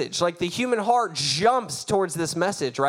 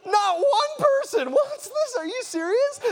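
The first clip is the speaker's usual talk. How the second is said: shocked disbelief. Retold or imitated. Preacher reliving an altar call nobody answered, shocked outcry. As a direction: protest in high-pitched disbelief. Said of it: "I was shocked".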